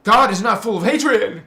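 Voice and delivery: loud, high emphatic denial; indignant shout. Real, not acted. Preacher denying God's hatred, loud and high. Rejecting hateful God.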